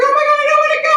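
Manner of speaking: high-pitched wailing protest; retold terror. Child in the car seeing axe-man, high mock wail. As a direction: imitate a child crying out in dread.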